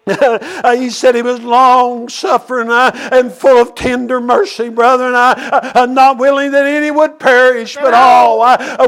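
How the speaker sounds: ordinary talk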